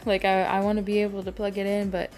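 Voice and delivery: usual speaking voice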